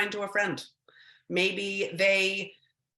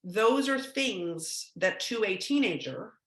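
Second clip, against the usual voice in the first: ordinary talk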